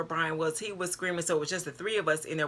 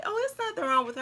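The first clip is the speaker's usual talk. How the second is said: high, light voice to reassure the worried mother; bright reassurance; 13 semitones higher, faster than usual. Retold or imitated. To the worried mother, a high bright reassurance.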